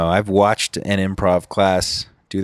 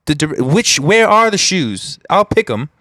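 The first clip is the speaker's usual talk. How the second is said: a light put-on British accent, higher and impatient; 7 semitones higher than usual. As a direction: keep the fake British voice and press for the shoes. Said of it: "a foreign exchange student from Britain".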